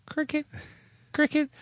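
soft, high chirped word; cricket imitation. Host mocking the silence after his remark, cricket chirp.

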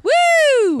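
high, loud woo cheer. Shown as a demonstration. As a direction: whoop like a crowd. Showing how they cheer.

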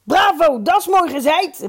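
high, loud heckle of approval; calling out. A narrator's reading. Dolf Hovie interrupts the chairman's speech, calling out high. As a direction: shout praise from the floor. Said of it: "riep Dolf Hovie".

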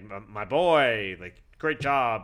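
slow, drawn-out imitation of a proud boss; lightly put-on voice, stretching the praise; McMahon. Boss praising his wrestler after an amazing match, drawn-out imitation. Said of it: "McMahon was like".

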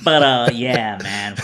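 ordinary delivery

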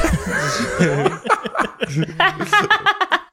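high, loud burst of laughter. Real, not acted. Cracking up at own protest to the group, loud laughter.

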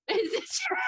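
high, laughing repeat of the mum line; giggling mock therapist question; laughing echo. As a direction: echo it while cracking up. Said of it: "tilt the head and say".

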